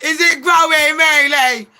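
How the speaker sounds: high, loud, giggly gullible prince; Prince Harry. Acted. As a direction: squeal with hammed-up delight. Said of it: "He's like".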